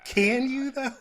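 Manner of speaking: incredulous. Retold or imitated. Repeating the mocking retort.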